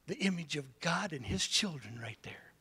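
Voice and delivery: hushed, breathless whisper; awed whisper. Retold or imitated. Preacher recalling his thought at the beach, whispered. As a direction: breathe it out like a secret revelation. Retelling a private tender thought.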